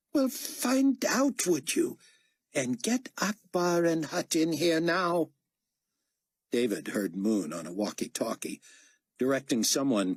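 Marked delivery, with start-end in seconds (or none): 0.1-5.3 high-pitched imperious commander; impatient potentate. Acted. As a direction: order an underling about, voice raised in pitch. Carpathia orders his aide impatiently.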